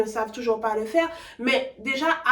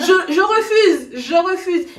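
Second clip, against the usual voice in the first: louder, laughing, playfully stubborn; laughing refusal; 5 semitones higher, 8 dB louder, slower than usual. Real, not acted. Refusing to lose her accent. (refus têtu en riant)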